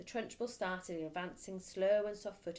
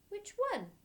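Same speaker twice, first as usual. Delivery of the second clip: much higher, innocent question; small boy; 13 semitones higher, 3 dB louder than usual. Acted. Boy asking the headmistress, high and innocent. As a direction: ask it like a puzzled child.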